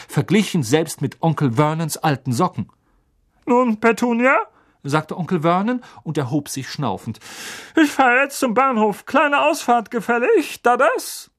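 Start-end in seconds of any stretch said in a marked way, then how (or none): 3.5-11.3 high, loud put-on uncle voice; blustering uncle. A narrator's reading. Uncle leaving for the station, blustering. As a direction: bluster heartily. Vernon addresses family.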